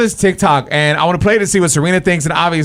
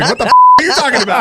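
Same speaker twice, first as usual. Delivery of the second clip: fast, louder, much higher in disbelief; excited outburst; 9 semitones higher, 5 dB louder, much faster than usual. Real, not acted. Host cuts in on friends' golf joke, fast and loud. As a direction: blurt it out quick and incredulous.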